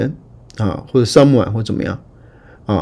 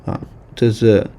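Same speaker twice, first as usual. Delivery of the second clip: usual speaking voice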